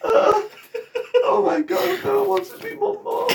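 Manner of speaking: high, laughing cries; laughing wail. Real, not acted. Crying out while laughing.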